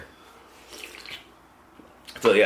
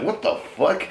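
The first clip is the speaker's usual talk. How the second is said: high-pitched retold shock; shocked protest; 11 semitones higher, 3 dB louder than usual. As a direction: act out a startled objection. Retells his shocked protest to girl.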